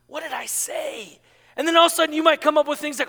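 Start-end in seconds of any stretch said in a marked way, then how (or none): 0.1-1.2 soft, slow, drawn-out worry; anxious self-questioning. Acted. Worried recipient combing back over past months, soft and fretful. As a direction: ask yourself slowly and quietly.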